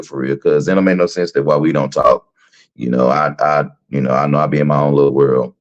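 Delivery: deep, low fake-sincere check-in call; mock phone message. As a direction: drop the voice low, pretend to be caring.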